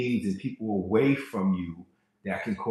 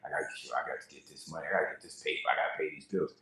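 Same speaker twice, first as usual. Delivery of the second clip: mumbled, quick, lower and much softer; mocking hustle talk; 6 semitones lower, 9 dB quieter, much faster than usual. Preacher mimicking broke talk to the congregation, a low fast mumble. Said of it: "they don't speak about money like".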